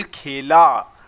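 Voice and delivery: खेला slowly, with a long final "aa"; खेला ending on a held "aa" sound; stressed आ ending. Shown as a demonstration. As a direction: draw out the last vowel of खेला. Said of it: "last में जो sound आ".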